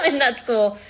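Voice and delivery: high laugh running into the words. Real, not acted. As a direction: laugh through the ending. Laughing about being non-hippie.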